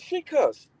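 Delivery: put-on high childish protest; high pleading kid. Boy blaming his sister in front of dad. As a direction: whine back like a caught kid. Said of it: "I said".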